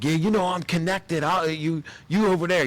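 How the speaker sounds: a hint of a mobster bragging; mock Italian tough guy. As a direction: play the connected wiseguy, half in jest. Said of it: "Italian guy, he's like".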